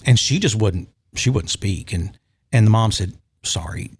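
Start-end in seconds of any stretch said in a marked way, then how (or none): 3.4-3.9 soft unvoiced whisper of sorry; a whispered apologetic sorry; hushed apology. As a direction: breathe the word almost silently. Mom apologizing for silent daughter.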